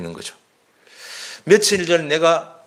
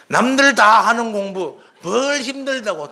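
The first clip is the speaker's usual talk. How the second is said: put-on scornful, slow and higher, brushing the child off; dismissive parent; 8 semitones higher, slower than usual. Parent brushing off a child who says studying is hard, scornful. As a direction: wave away the complaint with a sneer. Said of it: "또 이랍니다".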